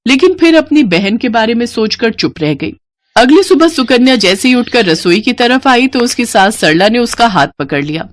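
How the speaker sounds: plain reading voice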